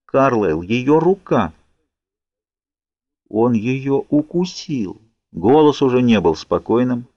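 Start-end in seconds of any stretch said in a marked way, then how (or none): 0.1-5.0 slowing, hushed horror; understated horror, no longer calm; horrified. A narrator's reading. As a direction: realise it with dread, drawing out the words. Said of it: "В нём звучал неподдельный ужас".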